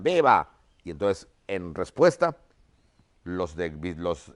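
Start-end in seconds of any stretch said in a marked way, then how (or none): 0.0-0.5 a short, louder cheered viva; crowd shout of viva. Retold or imitated. Teacher retelling the army answering the cheer, loud shout. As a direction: shout it back like the whole crowd. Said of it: "Y todos gritan".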